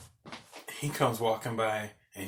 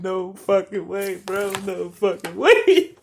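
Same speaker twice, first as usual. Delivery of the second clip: high, slow, stretched-out incredulity; laughing disbelief; 6 semitones higher, 5 dB louder, slower than usual. Retold or imitated. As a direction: rise to a loud whoop on the last word. Cracking up at roommate's story.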